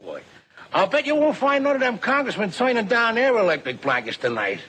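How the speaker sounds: ordinary delivery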